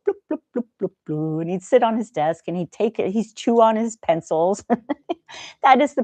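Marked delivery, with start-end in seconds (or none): 0.1-1.4 mock the pest wandering with bloops; goofy bloop noises. Homer wanders around the office making silly bloops. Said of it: "he'd walk around and go".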